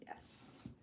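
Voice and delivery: a breathy, voiceless little yes; whispered yes. Real, not acted. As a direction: whisper it to yourself as it holds. Quiet triumph while gluing.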